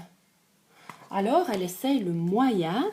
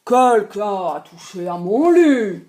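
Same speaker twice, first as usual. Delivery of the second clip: a louder gruff papa bear grumble; big bear growl; 8 dB louder than usual. Acted. Papa bear grumbling that someone touched his bed. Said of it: "grogne de sa grosse voix".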